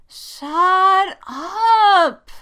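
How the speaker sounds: high, loud, long-stretched protest; drawn-out shout. A narrator's reading. Jessica reacts to being teased, wailing shout. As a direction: stretch both words into a yell. Teased again about crush.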